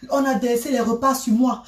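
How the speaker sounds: usual speaking voice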